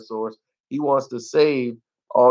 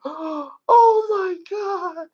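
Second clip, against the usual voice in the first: high, drawn-out hammed-up horror; mock shock; much slower than usual. Mimicking shocked critics in the theater, exaggerated shock. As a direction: imitate an aghast moviegoer, slow and high-pitched. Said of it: "people in the movie theater were shocked".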